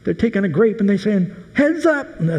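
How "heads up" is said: high playful shout; playful call. Retold or imitated. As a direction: call out as you toss a grape. Tossing grapes to the crowd.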